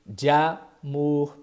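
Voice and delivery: "de amor" slowly merged into "damor"; slow elision. Shown as a demonstration. Teacher demonstrating sung elision, slow "de amor".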